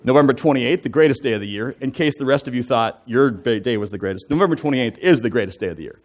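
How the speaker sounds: ordinary delivery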